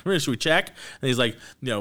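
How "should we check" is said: quoting the lawyer. Retold or imitated.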